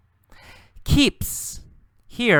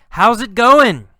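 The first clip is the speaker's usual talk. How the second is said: loud, high called greeting; yelled greeting; 9 semitones higher, 10 dB louder than usual. Acted. Carlos yelling to the girl, high and loud. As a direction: yell it across to her. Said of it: "And then Carlos yells".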